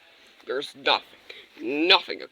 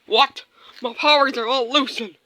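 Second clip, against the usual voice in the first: loud, high outraged villain; villain shouting in shock; 11 semitones higher, 11 dB louder than usual. Pretend. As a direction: bellow in disbelief as your powers fail. Villain loses powers, shouting.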